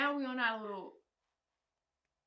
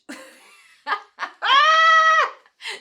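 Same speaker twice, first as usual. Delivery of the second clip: a shrieking laugh, high and loud; laughing out loud at the insult; loud burst of laughter; 23 dB louder than usual. Real, not acted. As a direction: crack up hard and loud.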